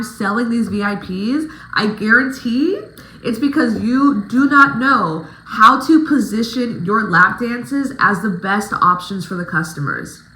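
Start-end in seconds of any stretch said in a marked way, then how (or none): none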